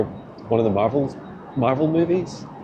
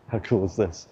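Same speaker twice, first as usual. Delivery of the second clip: ordinary talk, nothing marked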